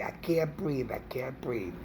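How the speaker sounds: a hammed-up breathless whine; mock breathless plea. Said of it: "she started saying".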